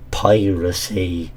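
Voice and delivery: usual speaking voice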